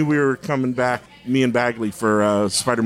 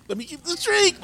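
squeaked much higher; high-pitched whiny plea; 4 dB quieter than usual. Retold or imitated. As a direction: whine it way up high.

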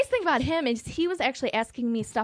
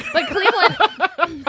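laughing; 5 semitones higher, 5 dB louder, much slower than usual. Real, not acted. Giggling through her story.

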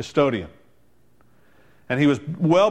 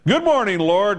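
louder, higher hearty call; big booming greeting; 6 semitones higher, 4 dB louder than usual. Retold or imitated. Sexton greeting the Lord in a booming call. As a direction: boom it out to the room.

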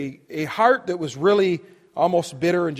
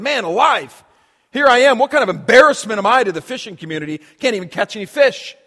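louder, higher, fed-up self-mockery; exasperated grumble; 5 semitones higher, 9 dB louder than usual. Peter mutters to himself over empty nets, exasperated and louder. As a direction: vent like a fisherman who caught nothing, fast.

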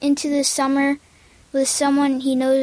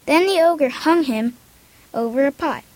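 ordinary talk

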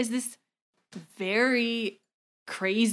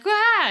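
high startled scream, half acted; scream of fright; 13 semitones higher, 7 dB louder than usual. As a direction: yelp like a grabbed girl. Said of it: "she's like".

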